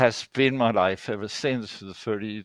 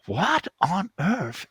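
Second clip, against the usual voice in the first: surprised; 7 semitones higher, slower than usual. Retold or imitated. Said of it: "And I was like".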